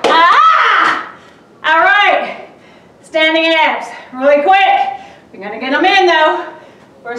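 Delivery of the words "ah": effort shout. Real, not acted. Effort at end of set.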